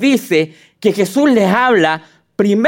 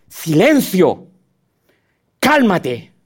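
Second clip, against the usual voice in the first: commanding the sea; much slower than usual. Acted. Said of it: "ordenó al mar".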